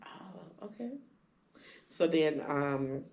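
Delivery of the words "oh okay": much softer and higher; resigned little okay. Retold or imitated. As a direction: let it go quietly. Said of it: "And I'm like".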